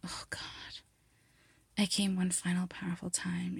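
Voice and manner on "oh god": ordinary delivery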